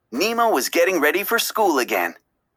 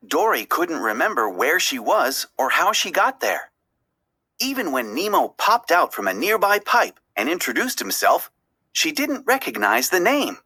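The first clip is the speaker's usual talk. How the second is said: ordinary delivery, nothing marked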